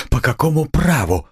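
high, indignant challenge; offended. A narrator's reading. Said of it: "вскричала обиженная девушка".

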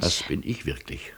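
a soft, breathy whisper; whispered sincerity. Acted. Guest answers the old landlady in a whisper. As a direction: confide it under your breath.

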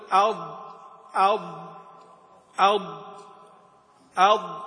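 "أض" repeated, each one stopped firmly on a held ض; pressed Dad. Shown as a demonstration. Teacher drills the correct articulation point, "أض" firmly pressed. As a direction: separate drilled "أض", tongue edge pressing on every ending. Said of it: "هكذا".

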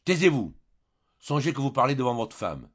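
ordonner fort, plus haut; ordre sec. Acted. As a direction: couper Julien d'autorité. Le baron tente de l'arrêter. (le baron fait taire Julien, d'un ton impérieux)

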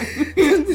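high rising laugh; giggling fit; loud laugh. Real, not acted. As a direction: crack up again. Friends recalling funny scene.

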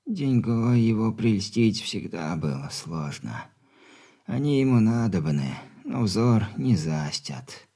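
weak stern dying father; very old man. Acted.

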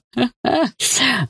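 high burst of mocking laughter. A narrator's reading. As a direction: laugh in her face. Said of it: "расхохотался рыжий".

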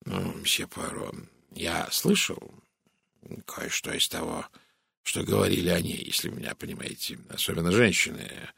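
low, hushed, hesitant murmur; hushed man-to-man confidence. A narrator's reading. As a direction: confide gossip discreetly, haltingly. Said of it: "он понизил голос".

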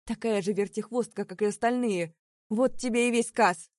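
loud slurred jeer; drunken shout. A narrator's reading. Drunk Willy shouting at Sara.

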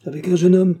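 ordinary delivery, nothing marked

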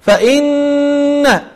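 فإن with the nasal n held two counts; ghunnah. Shown as a demonstration. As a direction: hum the doubled n of فإن. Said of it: "الصحيح أن تقول".

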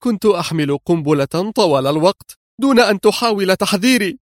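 high, outraged woman's voice; indignant anger. A narrator's reading. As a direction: protest in angry disbelief. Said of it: "كررت بغضب هادر مستنكر". (غضب مستنكر بصوت مرتفع)

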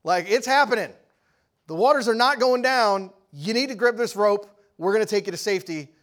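acted urgent bullhorn warning; bullhorn shout. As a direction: holler down from a helicopter. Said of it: "they take the bullhorn out".

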